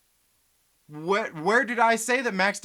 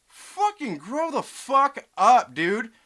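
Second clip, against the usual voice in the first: slow, loud, angry words; furious drawn-out scolding; angry rant; 2 semitones higher, 4 dB louder, slower than usual. Real, not acted. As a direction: tell him off hard. Ranting at critics.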